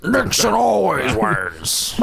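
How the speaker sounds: high, slow, drawn-out politician caricature; cartoonish mock president; Richard Nixon. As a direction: stretch it out in a hammed-up presidential voice. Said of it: "Richard Nixon just".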